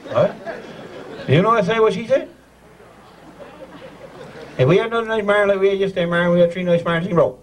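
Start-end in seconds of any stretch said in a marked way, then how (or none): 4.6-7.4 rapid Newfoundland patter, words run together; quick-fire, with "marn" in one syllable; fast tongue-twister. Shown as a demonstration. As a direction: rattle it off for the learner. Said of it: "you gotta say fast".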